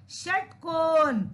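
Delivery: षटकोण slowly in two parts, long held ending; model pronunciation. Shown as a demonstration. Teacher has children say it again, षटकोण slow and measured. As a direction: sound out षटकोण slowly for repetition. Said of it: "दोबारा बोलिए".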